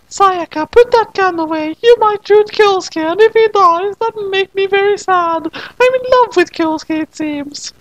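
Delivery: a dainty high-society greeting; high dainty girl greeting her friend; prim Hitomi voice. Acted. Hitomi pleading.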